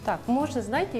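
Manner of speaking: ordinary delivery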